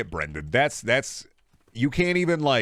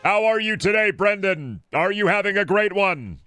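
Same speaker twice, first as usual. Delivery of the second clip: much higher, louder robotic text-to-speech read; old AI voice synthesizer; 11 semitones higher, 4 dB louder than usual. Pretend. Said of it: "like an old A I voice synthesizer".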